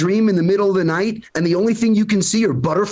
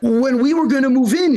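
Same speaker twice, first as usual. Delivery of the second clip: ordinary talk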